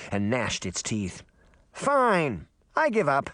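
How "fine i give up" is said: higher, lightly voiced boy; Puck's mock surrender. A narrator's reading. Fairy boy pretending defeat, high and cheeky. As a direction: give up with a shrug. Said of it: "a voice said".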